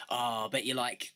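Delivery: usual speaking voice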